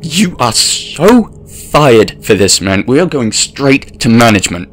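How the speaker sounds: rattled and furious, threatening to report him; shaken outburst. A narrator's reading. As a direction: narrator yelling at a fake actor who grabbed his friend. Shaken narrator confronts attacker.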